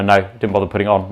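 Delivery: usual speaking voice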